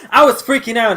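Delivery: loud, high, through real laughter; laughing. Real, not acted. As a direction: burst out with it while laughing. Laughing over her own outburst.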